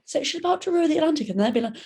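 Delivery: usual speaking voice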